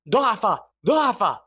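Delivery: high, loud eerie chant; mysterious voice. A narrator's reading. Mysterious voice to the team, loud repeated taunt. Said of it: "الصوت الغامض من جديد".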